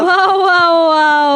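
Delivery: loud amazed wows; excited shout. Real, not acted. Loud reaction to teamfight.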